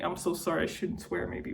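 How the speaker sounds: sheepish hushed apology; quiet aside. Real, not acted. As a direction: drop the voice for an embarrassed apology.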